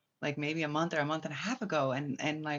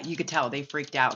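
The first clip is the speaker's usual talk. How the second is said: ordinary talk, nothing marked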